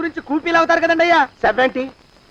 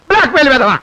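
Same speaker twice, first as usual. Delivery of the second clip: exclaimed abuse, voice raised; loud insult; 6 dB louder, faster than usual. Acted. Cornered man abusing his blackmailer, loud insult. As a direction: spit the insult out loudly.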